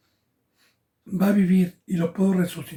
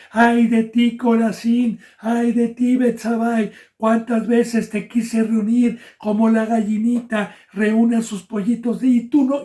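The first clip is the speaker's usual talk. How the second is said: loud, higher lamenting cry; weeping lament; 4 semitones higher, 5 dB louder, slower than usual. Acted. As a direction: cry out a grieving woe. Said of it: "Jesús iba llorando diciendo". (Jesús llorando sobre el pueblo, lamento fuerte y agudo)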